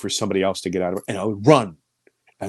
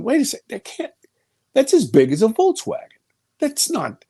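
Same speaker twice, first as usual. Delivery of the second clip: much higher, rising alarm, wait a sec; alarmed disbelief; 12 semitones higher than usual. Retold or imitated. Telling Jim how he realized it was huge, high alarmed disbelief. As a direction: catch yourself as the truth sinks in. Self-quote, realizing its true size.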